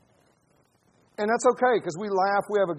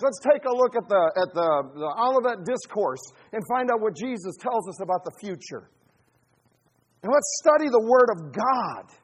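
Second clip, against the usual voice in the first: loud, raised rallying call to study Scripture; full-force preaching; 5 semitones higher, 5 dB louder than usual. Real, not acted. Preacher urging the church toward Bible study, loud and fervent. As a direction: rouse the saints to the Word.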